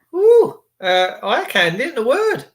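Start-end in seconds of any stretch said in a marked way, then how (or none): 0.1-0.6 much higher, loud woo; excited whoop. Real, not acted. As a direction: let out a cheeky whoop. Excited whoop at pretty viewer.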